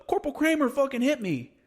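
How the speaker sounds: high-pitched imitation of a complainer; mocking tattletale. Kid telling on corporal to gunny, mocking tattle.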